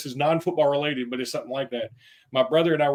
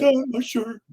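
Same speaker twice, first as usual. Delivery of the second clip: put-on kid voice; 8 semitones higher, slower than usual. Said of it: "he did just like this".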